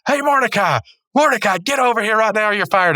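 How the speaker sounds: high-pitched imitation of a boss yelling; mock angry boss. Boss firing Mordecai on the spot, mock angry yell. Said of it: "he turns around, he says".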